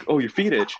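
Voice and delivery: high-pitched dad, settling the matter; retold dad. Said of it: "My dad's like".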